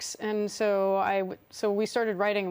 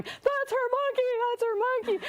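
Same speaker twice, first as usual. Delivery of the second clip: put-on high excited child's yell, much higher; little girl yelling. Little girl behind the ropes yells to the crowd, high and excited. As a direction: shout it like a thrilled little girl. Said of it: "She starts yelling".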